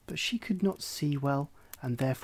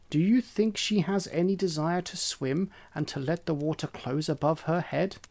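sarcastic hen. Acted. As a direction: sneer at the silly idea. Hen mocks duckling.

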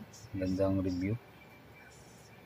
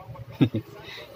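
breathy embarrassed laugh; 2 semitones higher than usual. Real, not acted. Laughs at own stumbling.

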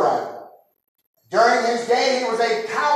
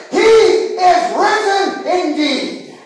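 slow, high, loud answering roar; thunderous crowd reply; 7 semitones higher, 4 dB louder, much slower than usual. Retold or imitated. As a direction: boom it back like thunder. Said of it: "came crashing like the sound of thunder".

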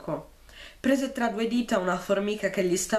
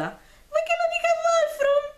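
very high, squeaky echo of the line; shrill little mocking voice; 4 dB louder than usual. A narrator's reading. Caspar mimicking Bartolomaus to mock him, shrill squeaky voice. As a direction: parrot it back in a shrill squeak. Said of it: "modulò una vocetta stridula".

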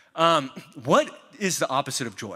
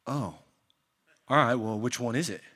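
mildly let-down waiter, softer at the end; deflated server; 3 semitones lower, 3 dB quieter than usual. Retold or imitated. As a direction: voice the waiter's sinking disappointment. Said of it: "the server's face just go".

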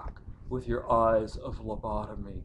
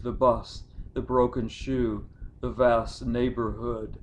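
usual speaking voice

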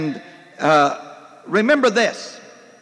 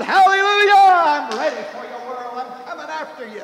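hollered; 7 semitones higher than usual. Retold or imitated. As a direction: holler like you just leapt from bed. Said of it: "and hollered".

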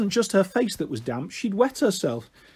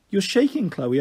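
usual speaking voice

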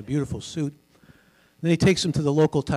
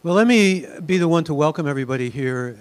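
booming broadcaster voice; 3 semitones higher, 7 dB louder than usual. Pretend. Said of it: "use my booming broadcaster voice".

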